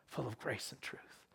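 whispered, almost under the breath; hushed reverence. Real, not acted. Preacher finishing the verse on the word made flesh, hushed reverence. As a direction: let it drop to an awed whisper.

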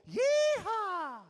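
high, loud cowboy whoop; yeehaw shout. Shown as a demonstration. Worship leader showing the congregation the song's whoop, loud high yeehaw. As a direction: whoop it like riding a horse. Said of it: "And we all go".

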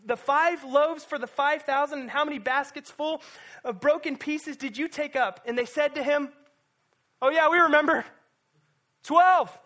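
high, loud, fervent preaching; preaching at full force. Real, not acted. Pastor urging the church, preaching at full force. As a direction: preach it hard to the room.

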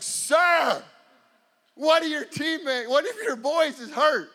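loud, urgent reprimand; indignant referee. Retold or imitated. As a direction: cut through the noise to scold a coach. Referee scolding the screaming coach.

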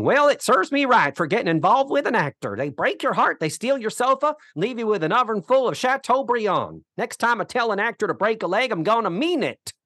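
high-pitched, hammed-up complaint tirade; Mama. Woman griping about involvement with an actor, imitated complaint tirade. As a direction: rant in a put-on sitcom grumbler's voice. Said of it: "some sort of a complaint tirade".

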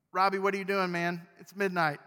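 what are you doing, man, at this hour; exasperated at midnight. Pretend. Neighbor knocking at midnight.

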